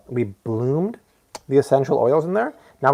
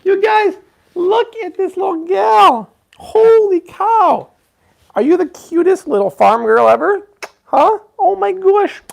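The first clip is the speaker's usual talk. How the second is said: very high, slow, loud cooing; over-the-top high-pitched doting; 6 dB louder, slower than usual. Real, not acted. Gushing over baby in outfit.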